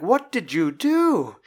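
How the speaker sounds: accusing coworker. Retold or imitated. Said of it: "And it's like".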